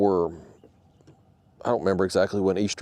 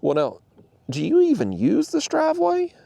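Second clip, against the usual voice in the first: mocking neighbor. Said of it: "he was like".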